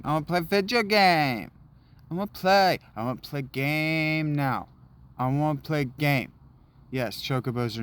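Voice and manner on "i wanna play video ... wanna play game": put-on higher, louder childish whine; mock toddler tantrum; whiny child. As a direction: demand like a cranky kid.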